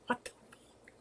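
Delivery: breathless whispered "What the fuck?"; a hushed aside to herself; whispered curse. Real, not acted. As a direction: mutter it under your breath in disbelief. Aside about turning sixty-two.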